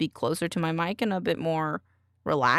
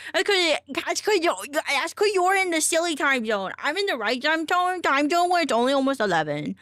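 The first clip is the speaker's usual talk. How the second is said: high, playful teasing voice; silly goober voice; 8 semitones higher than usual. Pretend. Teasing a chatter about time zones in a high goofy voice. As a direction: squeak it out like a goofy kid. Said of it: "sorry, that was, like, super goober".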